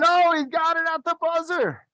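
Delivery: high, delighted cheer; excited cheer. Real, not acted. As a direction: cheer the buzzer catch, way up high. Cheering the last-second catch.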